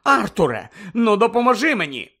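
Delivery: high, loud plea for help; exasperated appeal, pitched high; appealing to husband. A narrator's reading. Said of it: "обернулася до чоловіка місіс Візлі".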